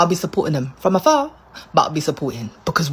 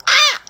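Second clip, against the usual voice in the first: sudden high yelp of frustration; short high pained cry; exasperated cry; 5 dB louder than usual. Real, not acted. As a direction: let out a yelp of despair. Exasperation over prices.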